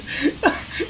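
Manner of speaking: silent wheezing laugh; breathy laugh. Real, not acted. Gamer laughing at a double-teamed opponent, wheezy laugh. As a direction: laugh without voice.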